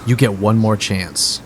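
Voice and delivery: slow, measured warning; stern ultimatum. Acted. Bard tossing an axe to his opponent, stern. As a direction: space it out as a final offer.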